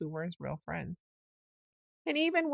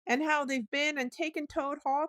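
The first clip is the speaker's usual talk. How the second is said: higher, urgent telling; 9 semitones higher, 4 dB louder than usual. A narrator's reading. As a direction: break the bad news sharply. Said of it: "continued the rat".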